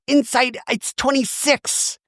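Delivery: high, halting put-on Shannon voice; flustered mock Shannon; Shannon impression. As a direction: stumble through it in a mock voice. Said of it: "And Shannon goes".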